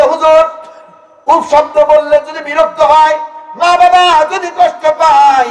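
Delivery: lightly voiced pleading listener; a hint of a worried follower asking the preacher; mock questioner. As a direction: play the earnest questioner, sing-song.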